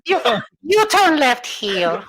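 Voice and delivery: high, slow navigation voice; gps voice. Pretend. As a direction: read it like a sat-nav, laughing. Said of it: "that's your G P S voice".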